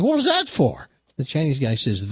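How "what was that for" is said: much higher, stunned mock outrage; dazed protest. Stunned cry. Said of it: "He looks at the Chinese guy".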